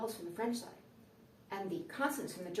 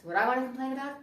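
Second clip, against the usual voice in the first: 1 mock complaining; 4 semitones higher, 5 dB louder, much faster than usual. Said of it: "honing my complaining skills".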